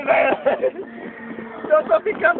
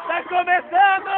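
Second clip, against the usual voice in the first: ordinary delivery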